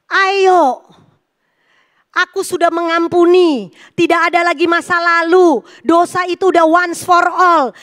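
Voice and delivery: loud, rousing sermon delivery; preaching at full force. Acted. Preacher rousing the congregation about forgiveness, loud and fervent. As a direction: proclaim freedom to the congregation.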